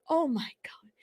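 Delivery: whisper. Real, not acted. Shocked reading viral post.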